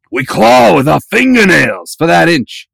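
slow, loud locker-room speech; Al Pacino. Retold or imitated. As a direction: growl out a coach's big speech. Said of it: "Yeah, just call Al Pacino".

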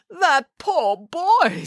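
high, slow pitying sigh. A narrator's reading. Nanny Piggins pities the boy, high and slow. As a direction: lament the poor boy slowly.

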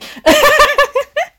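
high, loud laughter. Real, not acted. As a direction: burst out laughing in disbelief. Laughs at jump to conclusions.

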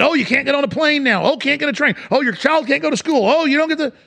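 high-pitched mocking list of bans; mock dismay. Mocking those enforcing the restrictions.

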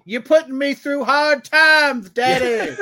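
high, loud, put-on wrestler drawl; wrestler promo voice. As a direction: ham up a cowboy wrestler talking trash. Mocking Mister Midnight's wrestler voice.

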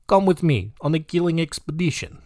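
mock villain inviting a friend in a Russian accent; comic Russian-accented invitation. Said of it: "I've got the Russian guy now".

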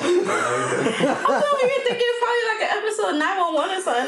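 laughing. Real, not acted. Guest laughingly explains her reaction to the hosts. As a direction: giggle through the words. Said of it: "I was crying laughing".

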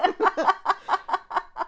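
high, loud burst of laughter; real high-pitched laughter; laughing fit. Real, not acted. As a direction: crack up helplessly at your own slip. Cracking up at tongue twister.

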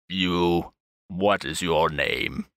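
low, slow interrogating voice; stern archbishop. Acted. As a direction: demand the name coldly.

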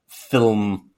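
film said in one syllable, fillm; careful film. Shown as a demonstration. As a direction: try saying film cleanly. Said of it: "I have to really try and say that".

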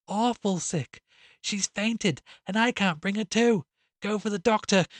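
high, anguished plea for help; distressed wail. A narrator's reading. Frantic sister begs neighbours for help, wailing. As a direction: plead urgently, voice pitched right up. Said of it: "moaned the distressed voice".

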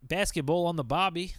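mock Australian accent. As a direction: toss off a cheesy Australian catchphrase. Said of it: "hey, Australian listeners out there".

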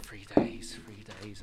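a madman murmuring to himself as he crawls off; hushed, trailing mad whisper; crazed whisper. Acted. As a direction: whisper it, fading to nothing. Mad druid crawls away muttering.

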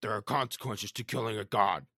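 loud stern warrior voice; Kratos voice. Retold or imitated. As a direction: boom the warning like Kratos.

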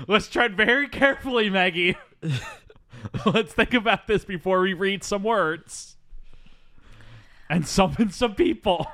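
high, hammed-up careful warning; nervous caution. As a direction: gingerly talk a child out of it, half laughing. Acted nervous warning to young reader.

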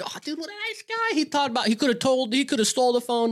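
very high, mock-gushing group reaction; gushing praise. Friends marvelling at the stranger who returned the phone, mock gushing. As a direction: gush in a put-on high-pitched voice.

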